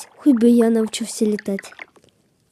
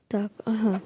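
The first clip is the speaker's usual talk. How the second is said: whispered aside; hushed thinking aloud; 5 semitones lower, 9 dB quieter than usual. Real, not acted. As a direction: whisper it under your breath. Whispers while playing.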